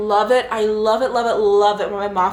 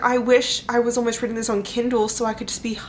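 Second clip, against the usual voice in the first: ordinary talk, nothing marked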